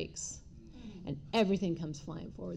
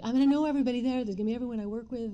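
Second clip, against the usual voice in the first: panicked rush; 4 semitones higher, 3 dB louder, much faster than usual. Retold or imitated. Panic about being seen.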